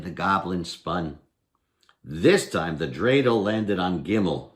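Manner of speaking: plain reading voice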